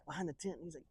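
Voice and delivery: usual speaking voice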